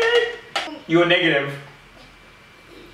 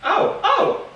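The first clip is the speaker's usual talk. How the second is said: surprised yelp. Real, not acted. Tasting a surprise flavor.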